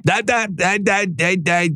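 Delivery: high, squeaky, stammered "that, that"; mock a flustered protester caught out; mock stammering arrestee. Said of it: "just say".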